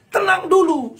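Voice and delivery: loud plea Tenang dulu. Real, not acted. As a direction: call out over the crowd. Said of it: "Marilah kita".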